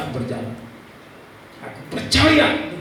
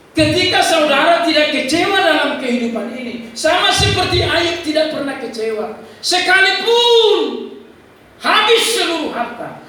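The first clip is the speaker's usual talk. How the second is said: full-force preaching; 13 semitones higher, 8 dB louder than usual. Real, not acted. Preacher holding up Ayub to congregation, full-force preaching.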